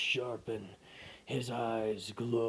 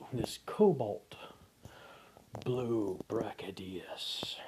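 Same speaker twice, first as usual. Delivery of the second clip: slow unvoiced whisper narration; tense whispered storytelling; hushed horror narration. A narrator's reading. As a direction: whisper the dread.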